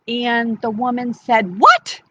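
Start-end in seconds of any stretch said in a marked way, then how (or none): 1.6-2.0 high, loud yelp of disbelief; shocked. Acted. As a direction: shoot the pitch up in shock. Woman learns foxes eat mice.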